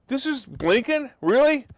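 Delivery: can't believe what he just heard; incredulous. Real, not acted. Vlogger scoffing at the Secretary of State's words, incredulous. Reacting to Blinken's quote.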